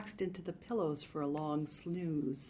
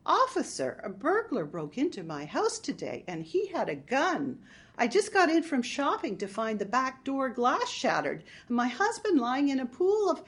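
higher-pitched, put-on victim's plea; hammed-up innocent victim; mock-distraught report; 7 semitones higher, 6 dB louder than usual. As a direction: feign distress for the police. Marge rehearsing fake report to police.

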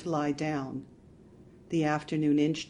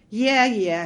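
careless; 6 semitones higher, 11 dB louder than usual. Acted. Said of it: "he said carelessly".

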